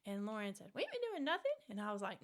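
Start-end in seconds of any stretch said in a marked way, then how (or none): 0.7-1.5 much higher, fake-innocent denial; sister's mock innocence. Sister lying to her mother about the smoke, high and innocent. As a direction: play dumb in a high little voice.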